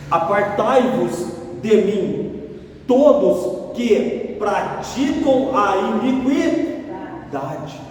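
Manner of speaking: each word drawn out slowly, like scripture proclaimed; slow solemn proclamation; solemn slow reading. Acted. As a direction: read the verse slowly and weightily. Solemn reading of psalm verse.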